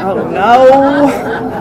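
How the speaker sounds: laughing dismay. Real, not acted. Dismayed at being known.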